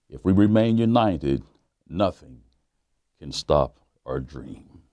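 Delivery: ordinary delivery, nothing marked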